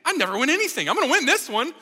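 put-on high, loud burst of kid confidence; excited ten-year-old. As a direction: blurt it like a kid sure of winning. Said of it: "at ten years old, was so excited".

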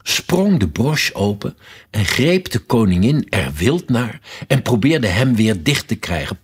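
plain reading voice

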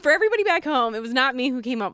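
loud, high insistence; emphatic protest. Real, not acted. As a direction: make sure everyone back home hears. Protesting joke isn't hers.

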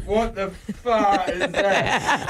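drawn-out shocked laughing cry; real shock at a hot mouthful; shocked outburst. Real, not acted. Hot sauce hidden in drink.

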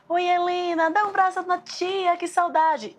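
put-on high, sing-song voice for a little girl; gushing auntie talking to a toddler; sweet baby talk. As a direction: coo at the child like a fond aunt. Adults greeting little girl sweetly.

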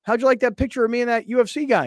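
high, loud, put-on innocence; baiting. Host retelling how he set colleague up, baiting. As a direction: pitch it up as a setup.

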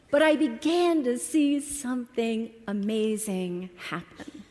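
ordinary talk

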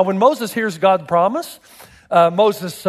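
normal talking voice

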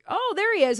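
high, bright surprised call; excited exclamation. Retold or imitated. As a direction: spot him suddenly and cheer. Voicing fans noticing Stone.

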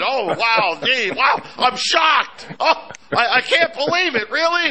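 high, exaggerated fake astonishment; mock shock. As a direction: act sarcastically stunned, pitch climbing high. Feigns shock at inflation news.

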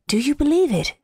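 ordinary delivery, nothing marked

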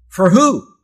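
high, loud question flung at the congregation; preacher shouting a question to the room; loud rising call. Real, not acted.

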